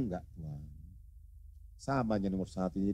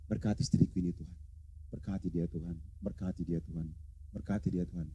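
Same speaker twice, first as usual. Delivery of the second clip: quiet repeated blessing, almost murmured; a hushed prayer so as not to wake her; soft murmured blessing; 5 semitones lower, 8 dB quieter than usual. Shown as a demonstration. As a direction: softly pray over a sleeping wife. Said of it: "tapi ucapkan berkat".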